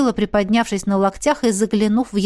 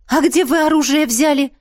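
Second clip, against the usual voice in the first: higher-pitched surprised question; 7 semitones higher, 4 dB louder than usual. A narrator's reading. Heroine asks the twins where they got weapons, surprised. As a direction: ask it in open surprise. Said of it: "удивилась я".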